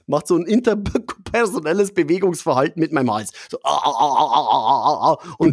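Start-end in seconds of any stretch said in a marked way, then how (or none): 3.6-5.3 laughing, shaken choking sounds; mock choking gasps. Being throttled by a stranger, mock choking gasps. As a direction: gasp as if someone shakes your throat. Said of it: "einer an der Gurgel und rüttelt".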